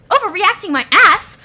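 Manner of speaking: loud, high, incredulous outburst; indignant retort. Acted. Ghost rejecting being told it overreacted, indignant. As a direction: throw the word back angrily.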